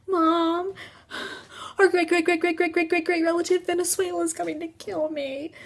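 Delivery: high, rapid-fire wail; mock screaming panic. Child running to parents from the ghost, high mock panic. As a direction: wail it to Mom in one breathless run. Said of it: "So I frickin' scream".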